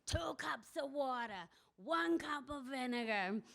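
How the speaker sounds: slow, lightly grand reading aloud; slow mock-imperious old woman reading a recipe. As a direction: read the recipe like a proclamation, a touch slow. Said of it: "held up the magazine kind of imperiously and started reading".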